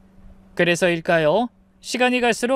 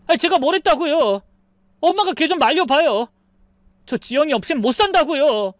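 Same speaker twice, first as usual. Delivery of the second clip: high, louder frantic plea; desperate son; 8 semitones higher, 3 dB louder than usual. Acted. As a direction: beg his mother in panic. 아들이 엄마에게 매달림. (애원하며 절박한 아들)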